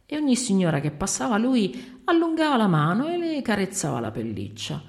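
ordinary delivery

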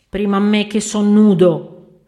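shouted claim to go first; loud protest. Acted. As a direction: shout over the whole queue. Calls out over the queue. (protesta urlata alla coda)

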